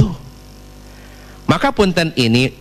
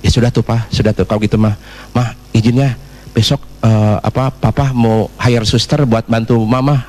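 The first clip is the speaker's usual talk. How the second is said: low-pitched acted husband voice; husband offering solutions; 9 semitones lower than usual. Husband offers to hire help for wife, low voice. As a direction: play a husband calmly proposing a fix, pitched low. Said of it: "Diterjemahkan oleh sang suami".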